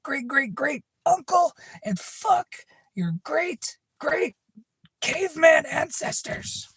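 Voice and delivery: very high, screechy mock tirade; imitating an over-the-top angry outburst; hammed-up furious rant. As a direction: spit out each curse in a shrieking falsetto. Acts out Josh's abusive reply.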